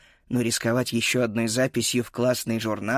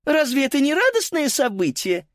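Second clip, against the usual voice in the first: high, screeching announcer; squealing loudspeaker; 13 semitones higher than usual. Acted. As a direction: shriek it over the speaker. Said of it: "Громкоговоритель перешёл на визг".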